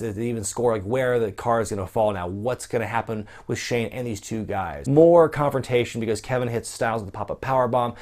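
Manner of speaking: ordinary talk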